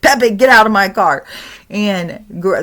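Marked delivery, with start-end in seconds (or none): 0.0-1.2 louder repeated command; angrier order. Retold or imitated. Grandpa repeating his order to the ghost, louder.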